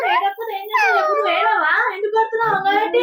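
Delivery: high, sobbing yell; tearful high-pitched outburst at the one hitting; crying shout. Real, not acted. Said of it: "మా పాప ఇట్లా ఏడుస్తుంది ఏంది?".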